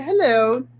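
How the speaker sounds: put-on a light British accent on the greeting. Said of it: "She had the British accent".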